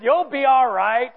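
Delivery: loud, slow, gruff-uncle reassurance. Retold or imitated. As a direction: draw it out loudly, a tough guy consoling. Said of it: "who was a big redneck, tough guy".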